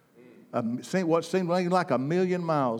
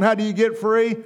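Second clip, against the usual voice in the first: ordinary talk, nothing marked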